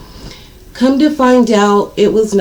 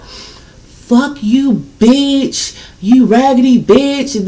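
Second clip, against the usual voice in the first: side chick cussing; 4 semitones higher, much slower than usual. Retold or imitated. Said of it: "This and that".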